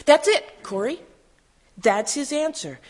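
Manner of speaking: high, bursting exclamation; excited realization. A narrator's reading. Sister finding the answer in the verse, excited realization. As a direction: break in with sudden, joyful certainty.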